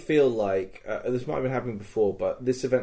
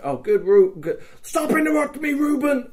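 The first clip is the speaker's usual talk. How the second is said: rising into a much higher, mock-exasperated squeal; mock exasperation; 14 semitones higher, 3 dB louder than usual. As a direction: build from fake praise to a high, playful mock snap at Ruben. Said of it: "I'm like".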